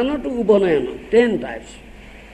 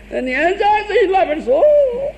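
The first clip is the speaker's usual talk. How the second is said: mock lament; 11 semitones higher, 4 dB louder than usual. Mocking the regretful enjoyer.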